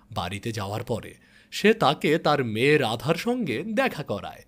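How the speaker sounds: plain reading voice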